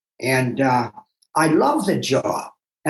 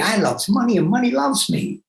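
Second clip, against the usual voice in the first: sing-song, rising higher on the motto; cute motto; 7 semitones higher than usual. Shown as a demonstration. Boasting to Rocky, lilting sing-song higher. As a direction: chant the motto, lilting and pleased. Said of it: "as cutely as I might be saying it now".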